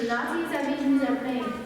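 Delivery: ordinary talk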